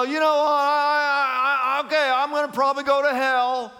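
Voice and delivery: lightly lampoon the sinner who shrugs off hell; mocking carefree sinner. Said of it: "And so they go".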